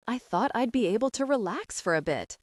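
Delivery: plain reading voice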